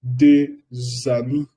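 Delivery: Deux amis very slow, the s linked as a z; slow liaison model for students; slow liaison demo. Shown as a demonstration. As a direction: stretch Deux amis, voicing the z. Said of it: "se pronuncia z".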